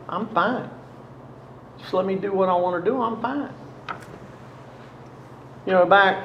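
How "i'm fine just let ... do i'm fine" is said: higher-pitched brush-off, imitating a younger self; lightly acted, impatient insistence; mock dismissive. Troubled son waving off his worried parents, mock dismissive.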